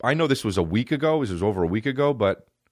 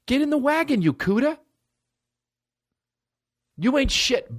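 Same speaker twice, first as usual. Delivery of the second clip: high ranting insults; 8 semitones higher than usual. Real, not acted. Yelling at critics.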